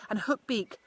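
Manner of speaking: ordinary delivery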